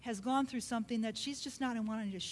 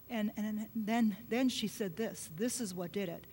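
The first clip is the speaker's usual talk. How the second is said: usual speaking voice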